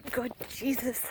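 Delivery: ordinary talk, nothing marked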